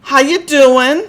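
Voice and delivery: loud, slow Brooklyn greeting; put-on Brooklyn holler; bad Brooklyn accent. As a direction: draw it out in a hammed-up Bronx accent. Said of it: "not a very good Brooklyn slash Bronx".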